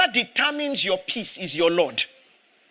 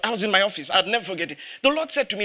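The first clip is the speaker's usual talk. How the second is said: ordinary talk, nothing marked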